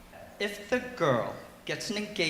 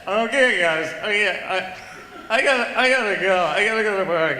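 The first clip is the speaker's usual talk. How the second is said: louder, slurring I gotta go; tipsy guy leaving; 2 semitones higher, 6 dB louder than usual. Acted. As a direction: push to leave, mock tipsy. Drunk guy leaving bar.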